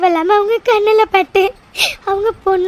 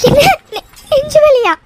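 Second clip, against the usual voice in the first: can't believe what she just heard; gasped shock at news of a heart attack; shocked disbelief; 5 semitones higher, 3 dB louder than usual. Acted. Hears mother's heart attack news.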